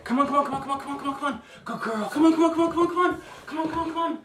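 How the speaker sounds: rapid squeaky-high puppy calling; high-pitched excited voice. Shown as a demonstration. Trainer demonstrates calling a puppy to come, high-pitched excited voice. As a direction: coax a puppy over with excited, very high chatter. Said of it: "Call 'em to you in an excited voice".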